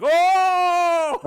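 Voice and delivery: loud high crowd gasp of alarm. Retold or imitated. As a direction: gasp like a whole room watching a near miss. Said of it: "the whole place was like".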